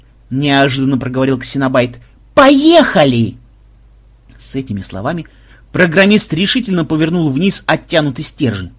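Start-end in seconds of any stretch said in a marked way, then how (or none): 2.3-3.4 slow, high, loud launch cry; triumphant shout. Acted. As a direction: draw out the big go. Inventor launches the device. (торжествующий крик)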